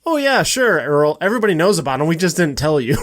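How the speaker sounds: loud mock agreement, pitched high then settling; sarcastic. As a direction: lay the sarcasm on thick while imitating. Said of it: "that's so sarcastic".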